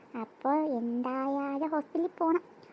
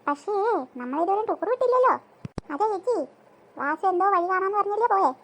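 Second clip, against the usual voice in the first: normal talking voice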